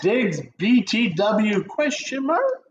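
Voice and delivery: "question mark" drawn out in a high questioning tone; exaggerated rising question on "question mark"; questioning read. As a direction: read it slowly, lifting "mark" into a big question. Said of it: "you got a question mark".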